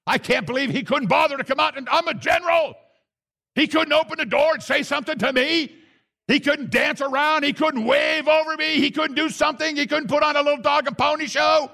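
high pitched, indignant rant; hammed-up outraged Naaman; furious general. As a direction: vent like an entitled general snubbed. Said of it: "He's furious".

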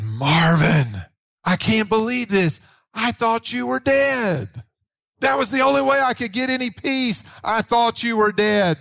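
high-pitched mock-shocked mother; astonished mother. Retells mother's shock on phone.

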